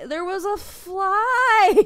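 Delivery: high, slow, loud squeaky pet voice; cat's excuse voice. Retold or imitated. Cat excusing herself to owner in a high squeaky voice. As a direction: voice the cat pleading its innocence.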